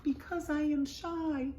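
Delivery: small, high, timid voice; trembling. A narrator's reading. Hidden owl shyly answers the animals, trembling. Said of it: "Then a trembling voice says".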